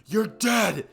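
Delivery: loud angry war cry; furious shout. Acted. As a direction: yell it as a battle threat. Rival charges in yelling.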